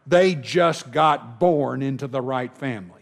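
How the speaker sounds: ordinary delivery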